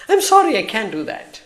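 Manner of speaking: high, far-off polite refusal; robotic assistant voice. Retold or imitated. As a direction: mimic the assistant apologising, pitched high. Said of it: "What is the point then?".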